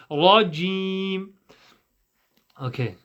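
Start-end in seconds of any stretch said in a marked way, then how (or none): 0.1-1.3 "Rajim" with a long ee through a smile; smiling JIM. Shown as a demonstration. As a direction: spread lips on the i of "Rajim". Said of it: "Imagine yourself smiling when praying jim".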